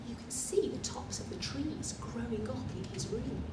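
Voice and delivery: quick unvoiced whisper. Reader pointing at the picture for children, whispering. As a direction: whisper it fast like sharing a secret.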